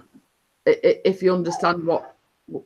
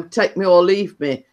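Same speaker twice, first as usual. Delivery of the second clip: ordinary talk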